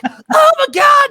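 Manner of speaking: very high, drawn-out panicked scream; mock scream. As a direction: imitate a man screaming in terror, slowly. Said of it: "And he's screaming".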